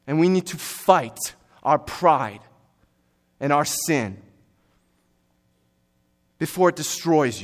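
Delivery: forceful, urgent sermon delivery; emphatic preaching. Real, not acted. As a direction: preach it hard, leaning on the key words.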